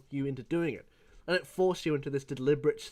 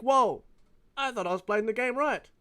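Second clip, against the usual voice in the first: put-on young hurt protest; 9 semitones higher, 3 dB louder than usual. Retelling his young self's protest.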